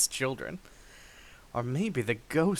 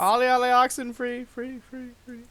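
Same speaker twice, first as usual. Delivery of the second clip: sing-song call; 11 semitones higher, 6 dB louder than usual. Acted. Calling out a game chant.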